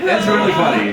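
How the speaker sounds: ordinary talk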